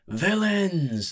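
high, loud, drawn-out showman's call; ringmaster's announcement. A narrator's reading. Ringmaster addresses the villains round the pit, high ringing call. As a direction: hail the crowd like a fight announcer. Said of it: "the ringmaster said".

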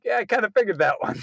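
high, laughing quip breaking into a shout; laughing retort. Retold or imitated. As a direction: say it while cracking up. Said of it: "I'm like".

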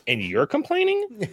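high, slow incredulous retort; mock disbelief.

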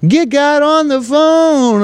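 very high, drawn-out preacher cry; hammed-up southern preacher, slow and high. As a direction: call to heaven like a southern preacher. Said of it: "I hit my fucking southern preacher voice there".

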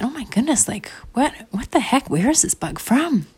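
high-pitched wondering questions; mystified. Acted. Mom examining the mysterious bug, high amazed wonder. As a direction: marvel at a puzzle, voice lifted. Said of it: "it was actually such a mystery".

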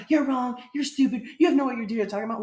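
mock angry yell, high and loud; imitated yelling. Someone shouting down the other side in an argument, imitated yelling. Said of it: "you're just yelling at me".

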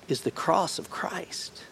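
hushed whispered question. Real, not acted. Preacher revealing the answer to the congregation, whispered. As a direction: breathe the answer like a secret.

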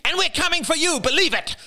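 very high sudden shriek; shocking shriek. A narrator's reading. Jada threatening the family, sudden high shriek. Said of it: "rose to a shocking shriek".

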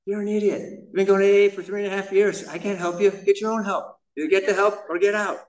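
a hint of the fed-up wife laying down terms; wife's voice. Wife tells husband to get help, lightly voiced. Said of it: "And she's like".

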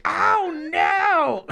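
high squeaky mock panic, half laughing. Cop squealing at a spitting suspect, mock panic.

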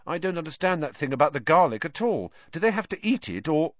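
high, fretful schoolboy; anxious. A narrator's reading. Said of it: "his round face anxious".

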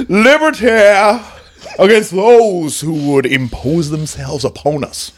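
hammed-up orator in a Scottish-Southern accent, cries leaping high; rally the people in a Churchill-meets-Southern-preacher accent; swooping Scotch-Southern oratory; mock Scotch-Florida American. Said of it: "Scotch Florida American".

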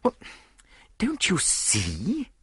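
high, slowly drawn-out exasperation; annoyed prompting. A narrator's reading. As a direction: spell it out to a slow friend. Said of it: "His unusual obtuseness annoyed me".

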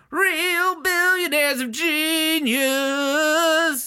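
grand beer commercial narrator; announcer voice. Pretend. Miller High Life ad parody.

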